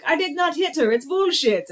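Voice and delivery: high, lightly voiced Tommy Wiseau; a hint of the bad actor's outburst; Tommy Wiseau impression. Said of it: "the scene where he's like".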